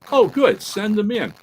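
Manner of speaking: high, bright hero line over intercom; mock radio hero; Britt's voice. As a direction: pitch up for the young hero. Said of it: "So Britt goes".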